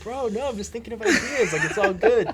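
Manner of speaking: high, loud playful protest; retold protest. Guest replaying his answer to a super serious friend, animated protest.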